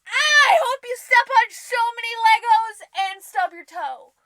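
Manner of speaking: loud high angry rant; furious yelling. Acted. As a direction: yell curses at the top of your voice.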